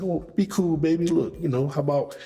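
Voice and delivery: high, smooth ladies' man voice, sliding into it; smooth talker. Pretend. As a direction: play it cool and charming to the woman. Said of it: "so smoothly like that".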